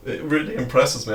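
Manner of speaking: real laughter breaking into the words; amused disbelief, laughing between phrases. Real, not acted. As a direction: talk through the giggles, pitch lifted. Amused at clip of own laugh.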